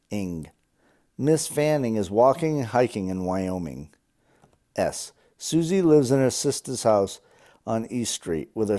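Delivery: loud, deliberate read-aloud; practice-sentence reading. Acted. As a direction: read each test sentence out loud and distinctly.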